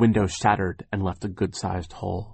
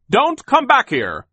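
high, slow, loud yell; yelling after someone; 8 dB louder, slower than usual. A narrator's reading. Sir yells after the escaping villains, high and loud. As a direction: holler it at the fleeing men. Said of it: "Sir yelled out after them".

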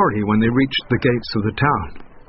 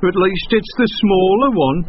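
higher grumpy donkey voice; grumbling donkey; 8 semitones higher, 4 dB louder than usual. A narrator's reading. Donkey grumbling as the son climbs on. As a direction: complain under the load. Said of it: "the donkey grumbled".

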